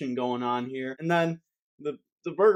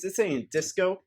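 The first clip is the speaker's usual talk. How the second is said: normal talking voice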